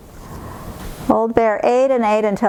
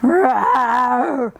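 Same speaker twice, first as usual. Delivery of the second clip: bear roar shout; 4 semitones higher than usual. Acted. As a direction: bellow like a hungry bear. Bear roars at the oven.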